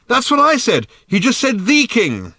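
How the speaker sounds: high, loud exasperated reply; shouted protest. A narrator's reading. Arthur repeats old man's words to Ford, shouting. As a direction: yell back, hitting "the" as "thee".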